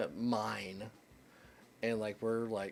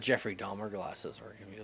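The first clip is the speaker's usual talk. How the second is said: usual speaking voice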